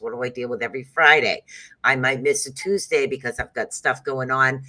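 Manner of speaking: ordinary talk, nothing marked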